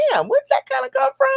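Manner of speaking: high, quick, puzzled outburst; quick high-pitched mock bewilderment; mock bafflement. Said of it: "if you have been a little emotional today and you're like".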